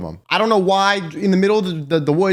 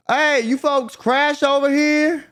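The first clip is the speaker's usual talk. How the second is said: high, slow country drawl; slowed, high imitation of the son; Bart's country voice; 8 semitones higher, slower than usual. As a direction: call out to stranded strangers like a rural guy. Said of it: "and he's like".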